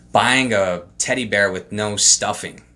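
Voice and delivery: ordinary talk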